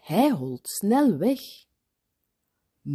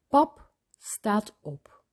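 ordinary delivery

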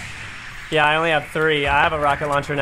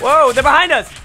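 high, loud startled yell; startled shout; 9 semitones higher, 6 dB louder than usual. Real, not acted. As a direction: shout in sudden alarm. Sudden alarm in fight.

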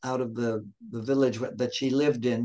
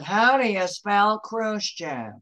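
higher, slower mimicked operator voice; mock the operator, higher and slower; snippy operator; 7 semitones higher, slower than usual. Operator asking the caller to spell Khrushchev, mock snippy voice. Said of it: "that bitch operator said".

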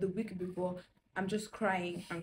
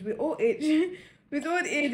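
laughing, higher and louder; 6 semitones higher, 4 dB louder than usual. Real, not acted. Laughing at herself to her viewers. Laughing repeat of old age.

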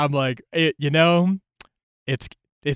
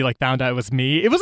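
usual speaking voice

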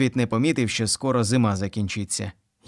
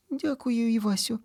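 put-on high girl's voice, softer, through tears; girl sobbing; 12 semitones higher, 4 dB quieter than usual. A narrator's reading. Manyunya thanking Ivas softly through tears. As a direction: thank him while sniffling. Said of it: "схлипуючи".

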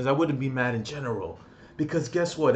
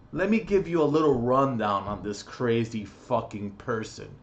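ordinary delivery

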